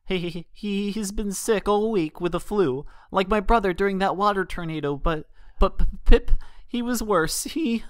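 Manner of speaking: high voice catching on every word; a frightened little colt, barely holding it together; stammering scared colt. A narrator's reading. Sick-with-worry colt tells the mares about his friend, high stammer.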